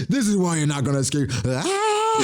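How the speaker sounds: high squealing mock roar; imitating War Curie's roar as a shrill screech; imitated roar. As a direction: ham up a villain's battle cry. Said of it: "War Curie just roaring".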